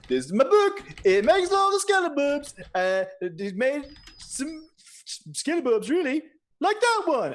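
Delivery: a vain king boasting; high king voice. Acted. King boasting about his skeleton-making book, high and proud. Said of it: "he's like".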